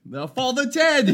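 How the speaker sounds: high, loud British exclamation; hammed-up British correction; mock British accent. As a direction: belt it out in a bad British accent.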